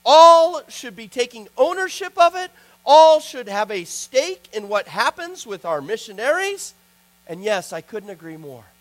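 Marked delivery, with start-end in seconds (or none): 0.0-6.7 higher, louder preacher's rebuke; retelling the speaker's fiery challenge to pastors; rousing exhortation. Retold or imitated. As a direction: build it into a call to the elders. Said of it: "Then he went on to say".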